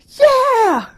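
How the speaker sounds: sudden high, loud "Yeah!" like at a ballgame; excited cheer. Retold or imitated. Fan at a basketball game cheers, loud and high. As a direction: whoop it out from the stands. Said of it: "they go".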